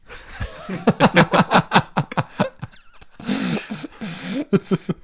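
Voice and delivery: high, sustained laughing; long laugh. Real, not acted. Laughing at tool-throwing remark.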